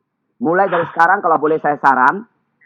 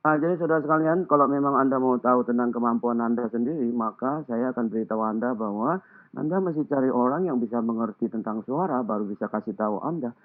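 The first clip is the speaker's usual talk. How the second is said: low, soft, mumbled words, as if talking with a mouthful of gargle; mumbling speaker; 6 semitones lower, 7 dB quieter than usual. Shown as a demonstration. Public speaking coach demonstrating a mumbler, muffled and soft. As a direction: swallow every word low and muffled. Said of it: "Kan ada orang bicara begini".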